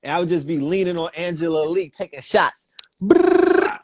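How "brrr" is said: high, loud rolled gunshot burst; playful tongue-rolled gun noise; mimed gunfire. Pretend. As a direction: trill a rapid machine-gun brrr. Miming taking shots at someone.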